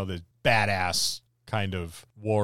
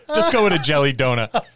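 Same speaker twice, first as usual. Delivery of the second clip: laughing; 4 semitones higher, 8 dB louder than usual. Real, not acted.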